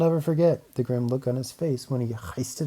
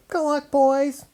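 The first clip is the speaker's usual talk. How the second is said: high, loud Lorax farewell cry; cried farewell; 5 dB louder, slower than usual. A narrator's reading. Lorax calling goodbye to the departing creatures, high and loud. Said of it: "he cried".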